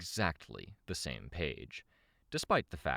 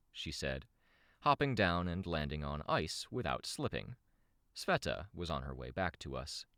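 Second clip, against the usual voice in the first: ordinary delivery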